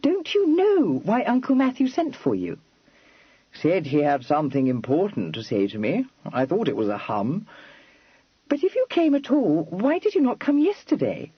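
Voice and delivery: Kitty, staring at Freddy, a touch exasperated; lightly voiced young lady Kitty. A narrator's reading.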